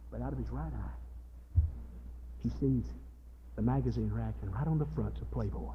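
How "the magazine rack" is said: normal talking voice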